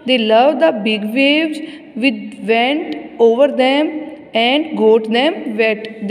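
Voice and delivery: very slow, word-by-word reading; measured classroom reading with drawn-out words; textbook read-aloud. Acted. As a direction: read it out slowly for young pupils. Reads textbook aloud slowly.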